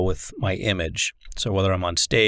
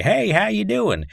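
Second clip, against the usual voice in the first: much higher put-on comedian accent, imitated; lightly imitating a stage comedian's fake accent greeting; Larry the Cable Guy accent; 11 semitones higher than usual. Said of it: "an imitation accent that he'll use".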